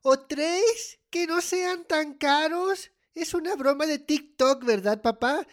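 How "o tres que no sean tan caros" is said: higher, louder, incredulous questioning; shocked disbelief. Acted. Child to dad after hearing the gift limit, shocked. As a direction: echo it back in loud disbelief.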